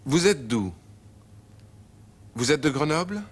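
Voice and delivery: ordinary talk, nothing marked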